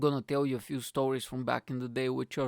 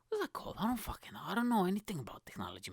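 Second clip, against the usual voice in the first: fast whispered aside; 6 semitones higher, 4 dB quieter, faster than usual. Real, not acted. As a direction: mutter it quickly under breath.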